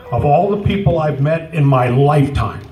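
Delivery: ordinary talk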